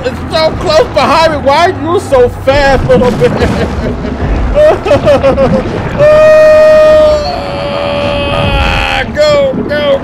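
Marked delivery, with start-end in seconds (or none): 3.4-8.3 panicked laugh. Real, not acted. Panicked laugh while fleeing.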